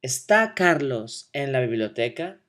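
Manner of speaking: "¿Está Carlos en la biblioteca?" with rising question intonation; question tune. Shown as a demonstration. Teacher to class, modeling a yes-no question with rising tune. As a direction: lift the end of "biblioteca" into a question. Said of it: "Repeat after me".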